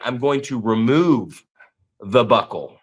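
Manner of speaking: deliberate, spaced-out threat, dropping low; slow warning. Retold or imitated. Coach warning his trainees to sell it, slow deliberate threat. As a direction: spell out the threat slowly, pausing before the last words.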